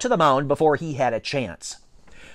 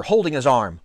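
ordinary delivery

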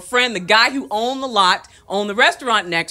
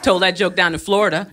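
ordinary talk, nothing marked

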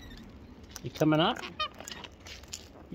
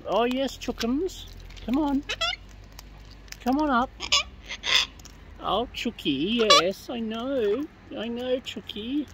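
cooing pet talk; 7 semitones higher, 4 dB quieter than usual. Real, not acted. To Chucky on the backpack, high soft cooing.

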